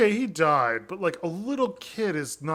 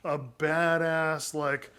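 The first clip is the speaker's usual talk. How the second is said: ordinary delivery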